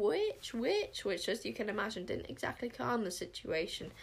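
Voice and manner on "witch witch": high rising shrieks; screaming alarm. A narrator's reading. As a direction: cry out the warning twice in panic.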